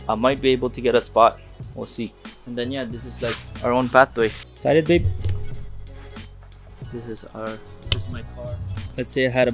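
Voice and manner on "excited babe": ordinary delivery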